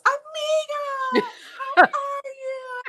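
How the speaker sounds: very high, loud, drawn-out mock yell; imitated friend's yell. Imitating friend who spots her in the sanctuary, high yell. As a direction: imitate a gushing friend calling across a room. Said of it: "she just yells".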